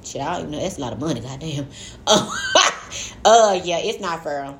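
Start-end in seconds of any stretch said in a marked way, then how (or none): 1.7-3.2 high squealing laugh. Real, not acted. Laughing at the sum.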